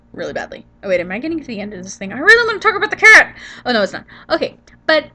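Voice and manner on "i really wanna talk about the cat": high, loud and fast complaint; frustrated outburst. Real, not acted. Vlogger upset the clip is ending, frustrated loud outburst. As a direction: blurt it out in frustration.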